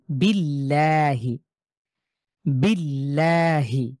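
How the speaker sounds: বিল্লাহি very slowly, recited, with a light, thin l; বিল্লাহি slowly, the l kept light. Shown as a demonstration. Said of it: "একটু বাঁকা করে পড়ছি".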